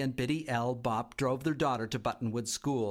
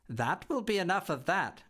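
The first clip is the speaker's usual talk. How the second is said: stern secretary; 6 semitones higher than usual. A narrator's reading. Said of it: "muttered Miss Priz".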